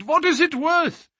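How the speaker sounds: anguished, agitated outburst; despairing cry. A narrator's reading. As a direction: lament it at the top of the voice. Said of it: "he cried".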